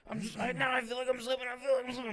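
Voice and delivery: high, panicky mimicry; mock panic, pitched far up; imitating panic. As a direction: act out a climber feeling the grip go. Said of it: "freaking out".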